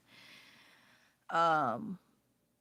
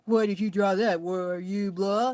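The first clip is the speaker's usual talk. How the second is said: mock complaining critic; 5 dB louder than usual. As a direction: imitate a whiny accuser, dragging the words. Voices critics of drawings.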